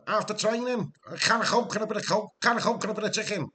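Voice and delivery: high-pitched, quick footballer impression; Carragher. Streamer showing off his best footballer impression, high and fast. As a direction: rattle it off in a high put-on voice. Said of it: "I do a better Carragher".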